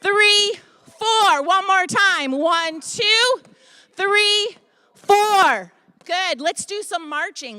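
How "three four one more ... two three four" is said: loud coach count-off; shouted punch numbers; called-out counts. Shown as a demonstration. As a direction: bark the numbers out to the class. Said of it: "Call the numbers".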